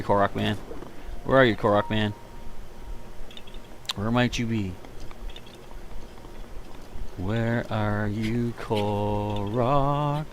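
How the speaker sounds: slow, soft, drawn-out calling; coaxing sing-song call. Pretend. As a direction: coax a hiding creature out. Calling for hidden Korok.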